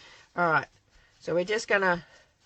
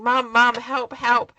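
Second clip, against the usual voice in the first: imitating crying son; 5 semitones higher, 9 dB louder, slower than usual. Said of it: "he's crying".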